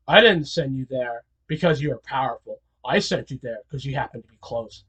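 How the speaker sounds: low, quiet dismissal; a hint of a disdainful boss; cold Muzan. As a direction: Muzan coldly belittling Akaza, low. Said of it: "he's like".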